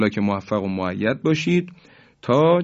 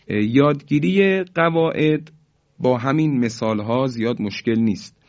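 normal talking voice

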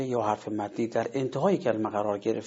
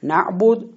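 نعبد said higher and louder, ending on a sukun; pausal form, emphatic; 7 semitones higher, 8 dB louder, slower than usual. Shown as a demonstration. Teacher showing how the word sounds when stopping on it. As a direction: hit نعبد hard as a stop.

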